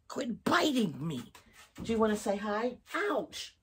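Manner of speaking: high, soft pet talk. Real, not acted. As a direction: coax the pet gently. Scolding and coaxing biting pet.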